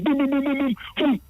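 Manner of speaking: thump out the booms like hits landing; imitated booms. Imitating blows in the kitchen.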